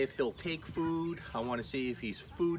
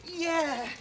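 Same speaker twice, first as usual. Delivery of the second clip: high, loud burst of joy; excited yes; 12 semitones higher, 5 dB louder than usual. Real, not acted. Owner cheering puppy's success, excited shout. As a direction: cheer the win.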